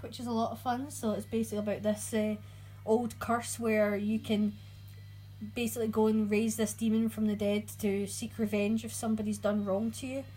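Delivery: ordinary delivery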